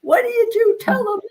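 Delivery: high, loud mock yell; retold yelling. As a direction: imitate him shouting down the phone. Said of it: "that's how loud he was yelling".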